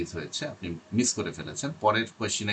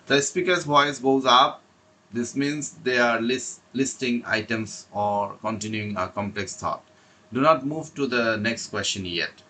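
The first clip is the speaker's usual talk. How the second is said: slow, deliberate reading of prepared notes; slow read-aloud; 3 semitones higher, 4 dB louder, much slower than usual. Acted. Teacher reading exam tips to students, slow and deliberate. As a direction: read each phrase out carefully, like a script.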